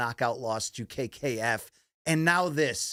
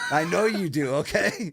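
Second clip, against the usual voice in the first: laughing tease; 5 semitones higher, 4 dB louder than usual. Real, not acted. Teasing co-host, laughing.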